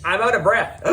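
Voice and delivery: high, animated read-aloud full of mock panic; dramatic story reading. Reading a braille story to viewers, high and theatrical. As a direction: act out the chase as you read it, pitched high.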